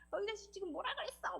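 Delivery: high-pitched but soft mock confrontation; mock angry outburst. Counselor imitating how people usually lash out, mock angry. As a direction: play at picking a fight, held back in volume. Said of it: "우리 보통 화가 나면".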